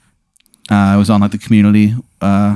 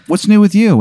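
chirpy waitress voice; 10 semitones higher than usual. Acted. Waitress small talk.

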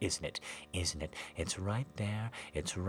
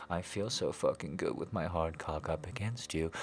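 normal talking voice

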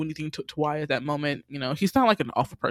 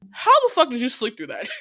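incredulous; 6 semitones higher, 4 dB louder, faster than usual. Real, not acted. Host reacts to the handcuffing.

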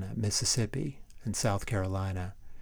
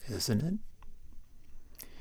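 drawn-out whisper of "Isn't it?", rising and much softer; whispered echo; 3 semitones higher, 7 dB quieter than usual. Acted. Repeated mocking aside.

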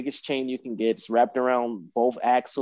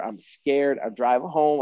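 normal talking voice

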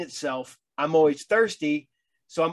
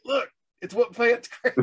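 movie quote voice; 8 semitones higher, 3 dB louder than usual. Retold or imitated. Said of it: "I'm like".

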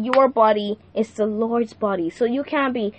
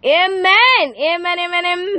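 joyful amen; 6 semitones higher than usual. Real, not acted. Joyful amens after the prayer.

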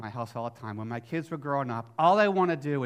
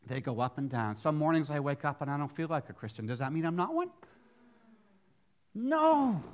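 ordinary talk

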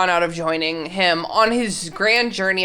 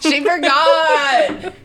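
laughing; 6 semitones higher, 4 dB louder, much slower than usual. Real, not acted. Laughing repeat of friend's joke.

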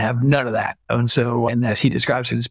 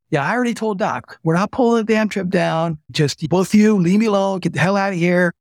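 imitating an angry boss; 7 semitones higher than usual. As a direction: act out the boss losing patience. VP angrily throwing them out.

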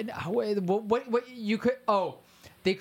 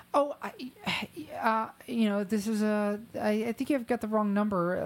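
high, put-on phone voice; disguised fake voice; 4 semitones higher than usual. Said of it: "And it's just like".

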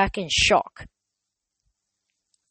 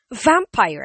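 startled stammer, a bit louder; 4 semitones higher, 6 dB louder than usual. Acted. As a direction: stumble over it in shock. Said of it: "I stammered".

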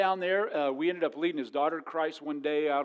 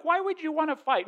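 higher, mildly puzzled question; women puzzled; 12 semitones higher, 4 dB louder than usual. Retold or imitated. Preacher imitating women asking men why they fight, higher. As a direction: lightly voice a baffled woman. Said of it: "The women are like".

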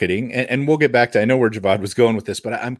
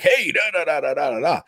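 high, singsong nonsense patter; mock pushy sales call; 6 semitones higher than usual. Mocking salespeople who cold call with nothing to offer, high singsong patter. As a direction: rattle off an empty sales pitch, high and mocking. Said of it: "Don't just call 'em and be like".

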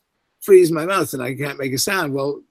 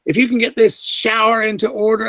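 exasperated assistant director; 6 semitones higher than usual. Retold or imitated. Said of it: "he was not very complimentary about them".

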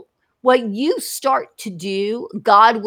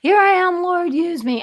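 high-pitched mock devotion; lightly mock an eager volunteer; singling out pious eagerness with a lifted, mocking voice; snarky; 6 semitones higher than usual. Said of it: "I don't mean to sound, you know, snarky".

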